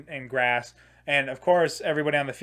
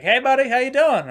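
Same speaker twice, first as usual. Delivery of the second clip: high, louder friendly cop greeting; cheery cop; 9 semitones higher, 6 dB louder than usual. Retold or imitated. Police officer greeting the stuck teen, high and chummy. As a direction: play the cop overly chummy.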